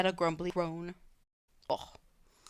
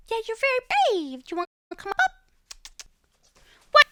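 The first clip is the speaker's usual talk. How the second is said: high sing-song pet voice; 11 dB louder, much faster than usual. Real, not acted. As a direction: coo up high like talking to a puppy. Baby talk to her dog.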